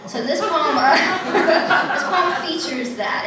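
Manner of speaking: loud laugh through the words. Real, not acted. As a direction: giggle it out, a bit embarrassed. Embarrassed laugh introducing poem.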